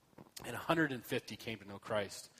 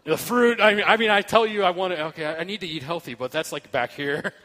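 loud, laughing through the words; can't stop laughing while confessing; laughing while talking; 5 semitones higher, 14 dB louder than usual. Real, not acted. Laughing loudly while telling the church about snacks. Laughing over the gift basket.

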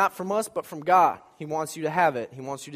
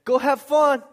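high, louder sing-song mom goodbye; cheery send-off; 9 semitones higher, 8 dB louder, slower than usual. Retold or imitated. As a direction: wave him off brightly like a cheerful mom. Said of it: "would say".

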